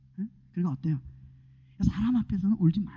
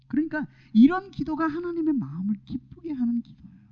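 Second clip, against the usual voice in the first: ordinary talk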